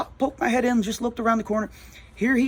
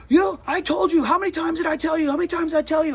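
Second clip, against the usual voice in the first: high, louder mock yell; retold yelling; 12 semitones higher, 3 dB louder than usual. Upset neighbor scolding the store owner for asking, imitated yelling. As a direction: imitate someone yelling in exasperation, pitched way up. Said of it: "And he's yelling".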